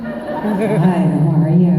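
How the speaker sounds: a light, creepy put-on American accent; slow, creepy American accent greeting a fan. Actress greets a fan in a creepy American accent. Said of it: "Do you like my American accent?".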